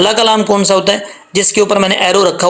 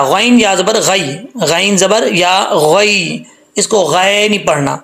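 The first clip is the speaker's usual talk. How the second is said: usual speaking voice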